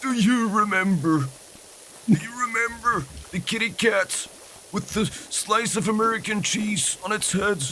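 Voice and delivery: put-on high, very slow elderly voice; old person reminiscing. Aged internet user telling the nurse about memes, high slow old voice. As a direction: quaver it out high and slowly. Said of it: "telling the nurse".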